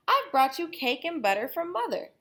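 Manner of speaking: put-on high girlish voice; Little Red voice. Little Red at Granny's door, high girlish voice. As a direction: chirp it sweetly.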